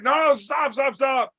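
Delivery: high, rapid-fire pleading; retold panicky peacemaker cry; urgent pleading. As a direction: voice jumps up, begging them to stop. Acting buddy breaking up fight.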